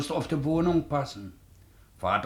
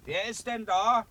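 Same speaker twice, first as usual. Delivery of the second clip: rufend; 11 semitones higher than usual. Acted. Ruft zur Tür hinaus.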